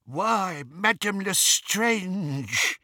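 high, drawn-out hail; surprised cry. A narrator's reading. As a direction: call out slowly in high-pitched astonishment. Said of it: "they heard a cry from behind them".

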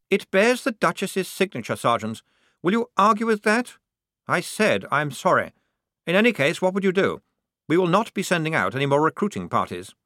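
high, officious officer voice; insistent lieutenant. A narrator's reading. Lieutenant refusing to argue with his sergeant, insistent.

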